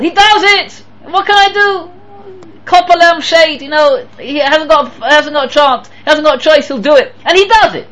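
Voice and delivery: high, strained mock exasperation; exasperated grudging. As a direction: complain loudly that there is no choice. Acts out grudging, burdened observance.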